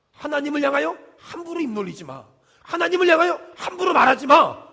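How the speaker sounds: stern command building to a shout; firm rebuke. Acted. God forbidding careless words against Him, acted firm rebuke growing loud. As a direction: forbid it, hitting the last order hard. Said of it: "단호하게 말하는 거예요".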